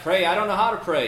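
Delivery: high, loud, incredulous yell; panicked yell back. Retold or imitated. John to his friend while fleeing a bull, yelling. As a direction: holler back in mock panic. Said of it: "John yelled back".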